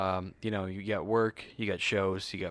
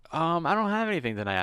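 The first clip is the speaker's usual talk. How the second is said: playing it innocent; 10 semitones higher, 6 dB louder than usual. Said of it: "And be like".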